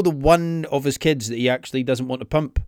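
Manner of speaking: ordinary talk, nothing marked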